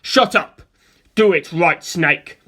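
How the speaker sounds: loud angry command; loud desperate yell. A narrator's reading. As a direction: bark the order at his stand. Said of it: "Pucci yelled".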